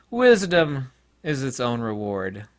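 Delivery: ordinary talk, nothing marked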